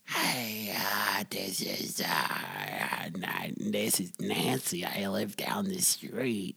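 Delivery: slow, whispery put-on old lady. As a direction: creak it out like a little old neighbor lady. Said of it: "maybe I'll do an old lady voice".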